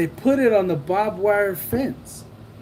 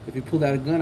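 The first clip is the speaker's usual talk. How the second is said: ordinary delivery, nothing marked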